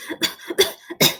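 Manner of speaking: choking cough. A narrator's reading. Doggy breath hits soldiers.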